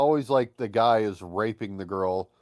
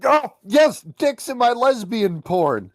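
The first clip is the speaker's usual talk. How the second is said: much higher and louder, thrilled; excited; 12 semitones higher, 8 dB louder, slower than usual. Real, not acted. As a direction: shout it out with glee. Excited exclamation.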